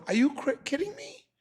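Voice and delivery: incredulous. Retold or imitated. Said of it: "He says to Aaron".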